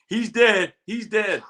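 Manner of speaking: high urgent call, loud at first; Tito. Retold or imitated. Teller imitating a platoon leader mid-firefight, high urgent shouting. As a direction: call it out high, second one easing off. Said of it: "you just hear him going".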